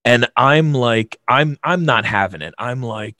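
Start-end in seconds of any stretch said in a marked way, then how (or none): none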